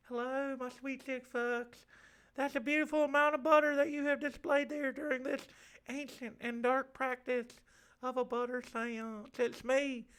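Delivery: high-pitched camp Southern spirit with a lisp; effeminate lisping Southerner. Acted. Summoned spirit greets the seance sitters, effeminate Southern lisp. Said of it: "the effeminate southern man with a lisp".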